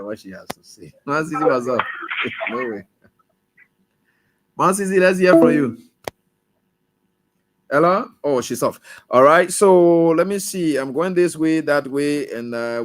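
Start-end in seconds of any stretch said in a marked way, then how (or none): 4.6-8.1 calling out to caller. Real, not acted. Host calling caller on air.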